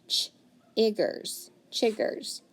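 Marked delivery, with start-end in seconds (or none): none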